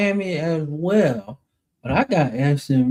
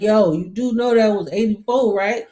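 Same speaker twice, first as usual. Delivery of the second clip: mocking retort; 6 semitones higher than usual. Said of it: "I'm like".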